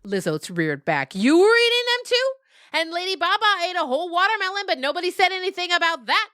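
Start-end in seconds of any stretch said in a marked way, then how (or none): 1.1-6.3 high, fast, aggrieved outburst; a goat complaining to the herd, much higher and faster; indignant protest. Acted. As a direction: rattle off the unfairness in a rush.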